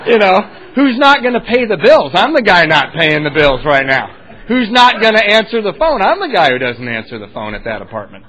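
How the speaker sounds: loud, high-pitched questions dropping into answers; hammed-up self-important objection; mock indignant protest. Drinker protesting thirty days of rehab to his brother, loud mock indignation.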